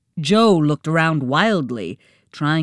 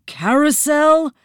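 higher, pleading guess; desperate guess; 9 semitones higher, 3 dB louder than usual. A narrator's reading. Jo guessing the riddle, desperate. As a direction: grasp at an answer in desperation. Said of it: "he uttered desperately".

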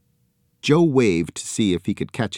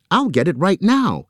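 high, louder eager promise; excited cry; 10 semitones higher, 5 dB louder than usual. A narrator's reading. Joe to the arm, high and thrilled. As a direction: burst out with joy. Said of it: "he cried".

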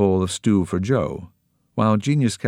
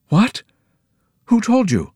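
shocked, much higher and louder; startled; 7 dB louder than usual. A narrator's reading. Nancy shocked that Pirandello knows their plan. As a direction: gasp and blurt it out. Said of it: "Nancy gasped".

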